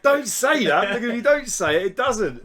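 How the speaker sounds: high, loud retold objection; mock panicked protest. Telling his co-host not to say it, mock panic. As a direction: blurt it out, hushing your co-host in mock alarm.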